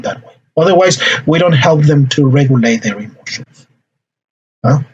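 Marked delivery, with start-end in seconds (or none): none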